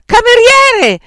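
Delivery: very high, full-voiced yell; urgent, imperious call; shouted summons. A narrator's reading. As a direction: holler for the waiter. Yells for the waiter.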